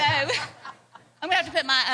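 amused reply through laughter; quick words tumbling out with a laugh. Real, not acted. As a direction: laugh along with being teased. Laughs at host's teasing remark.

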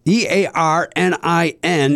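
slow letter-by-letter spelling; spelled out slowly. Shown as a demonstration. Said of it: "is pronounced Earnin".

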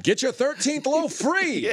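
slow, high crier's proclamation; town crier. Pretend. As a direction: call it out like a market crier. Joins the crier bit.

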